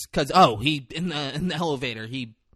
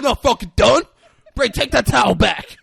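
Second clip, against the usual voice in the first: loud, high, half-laughing yell; retelling an angry friend, laughing shout; laughing mock shout; 11 semitones higher, 9 dB louder than usual. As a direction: bellow it through a laugh, hammed up.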